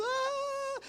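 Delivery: high wailing cry; mock crowd wail. Teachers missing their mothers, a high mock wail. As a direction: imitate the whole room bursting into tears. Everyone bursts into tears.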